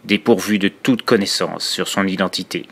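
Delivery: measured, subdued reading with pauses between verses; dark poem recitation. Acted. Horror storyteller reciting a poem about dark origins to listeners, sombre recitation. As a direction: recite it as a sombre first-person horror confession.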